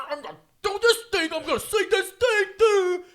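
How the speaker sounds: high, very loud caricature of a bad improviser; hammed-up, high-pitched stage yell; mock improv shouting. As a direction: burst in yelling like an overeager improviser. Mimicking bad improvisers bursting onstage.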